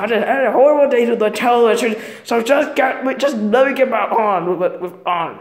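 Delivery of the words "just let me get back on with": ordinary talk, nothing marked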